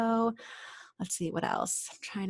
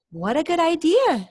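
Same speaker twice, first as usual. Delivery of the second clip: high, louder happy dragon; cheerful Zog; 8 semitones higher, 6 dB louder than usual. Acted. Zog thanks the girl, a high happy cry. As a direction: chirp it out delighted. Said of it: "said Zog".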